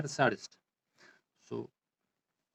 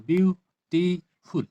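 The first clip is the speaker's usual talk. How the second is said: beautiful slowly split into beau, ti, ful; syllable by syllable; 3 semitones higher, 4 dB louder, much slower than usual. Shown as a demonstration. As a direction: spell out the three beats of beautiful with clear gaps. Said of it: "that is three syllable". (beautiful को धीरे, तीन अलग हिस्सों में बोलें)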